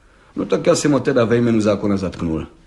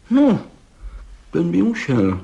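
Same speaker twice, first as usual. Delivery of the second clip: high-pitched, considering "well"; connoisseur's appraisal; 5 semitones higher than usual. Acted. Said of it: "mínil pan Hejda znalecky".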